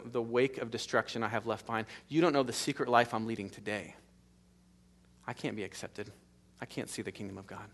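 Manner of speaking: voicing a hidden shame, hushed; quiet confession. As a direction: confide it quietly. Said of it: "you think in your heart of hearts".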